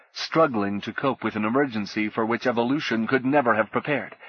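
ordinary delivery, nothing marked

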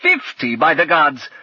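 loud, high exclaim; booming barbarian outcry. Acted. Thargor's excited outburst.

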